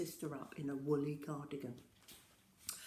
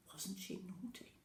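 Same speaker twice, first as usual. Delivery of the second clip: ordinary delivery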